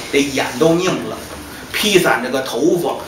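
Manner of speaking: plain reading voice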